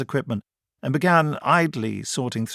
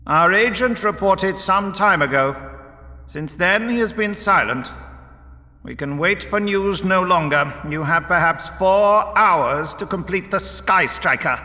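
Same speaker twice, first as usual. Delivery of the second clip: higher, urgent, imperious character voice; commanding leader Vorus; 8 semitones higher, 3 dB louder than usual. A narrator's reading. Vorus gives his engineer four hours, commanding leader. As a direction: lay down the deadline with authority.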